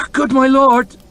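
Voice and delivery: high, loud pleading cry; a frightened last appeal; desperate stammer. Acted. Farmer desperately begging the lord chamberlain not to dismiss him. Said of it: "he stammered".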